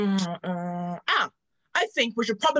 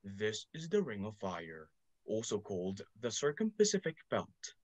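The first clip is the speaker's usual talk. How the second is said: lower, softer narrator voice; documentary narrator; 13 semitones lower, 10 dB quieter than usual. Acted.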